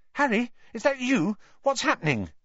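high-pitched bewildered questions; confused, anxious boy. A narrator's reading. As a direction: ask nervously into the dark. Confused boy groping in darkness.

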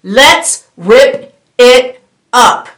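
very slow, drawn-out divine call; slow voice of the Lord. Acted. The Lord calling the body of Christ, very slow and drawn out. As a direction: stretch each word like God calling his people. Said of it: "And the Lord is saying".

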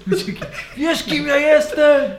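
hammed-up pompous noble, laughing; mock grandiose. GM echoes the boast, mocking.

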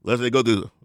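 normal talking voice

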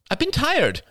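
high, defensive snap; snappish. A narrator's reading. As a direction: snap back in self-defence. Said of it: "she snapped".

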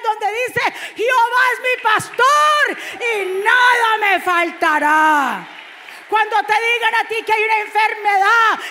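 gritado, lento y mucho más agudo; predicación a todo pulmón. Real, not acted. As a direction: proclama la palabra con fervor de púlpito. (predicador proclamando a gritos, lento y agudo)

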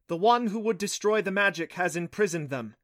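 lightly voiced goddess revealing a dark truth; hissing goddess. A narrator's reading. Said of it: "the voice hissed".

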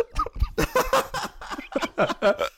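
high-pitched burst of laughter; laughing. Real, not acted. Friend hears the ambulance twist, bursts out laughing.

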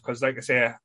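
usual speaking voice

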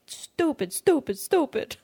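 high mock self-scolding; high, mock-frustrated "stupid, stupid". Scolding herself when pulled over.

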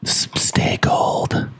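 breathy dying whisper; whispered quote. Retold or imitated. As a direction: whisper it slowly, fading. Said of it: "he goes".

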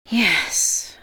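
sighed out. A narrator's reading. Caller gives in to the receptionist, sighing. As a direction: let the word out on a sigh. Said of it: "I sighed out".

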